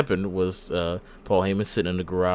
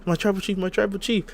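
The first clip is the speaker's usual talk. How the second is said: sad puppy dog; 9 semitones higher than usual. Retold or imitated. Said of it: "a sad puppy dog".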